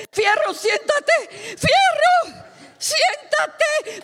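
very high-pitched frantic cry; frantic wife calling. Retold or imitated. Wife chases Fierro with wheelchair, frantic high calling. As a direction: shriek after him, pitch leaping up. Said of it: "la esposa siguió con el sillón de ruedas".

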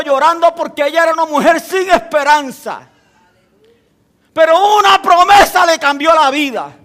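loud, high, driving preacher's voice; full-force preaching. Acted. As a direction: preach it out to the congregation, building.

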